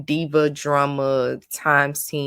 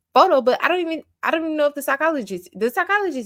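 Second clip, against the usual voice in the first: incredulous; 9 semitones higher, 3 dB louder, faster than usual. Real, not acted. High-pitched disbelief.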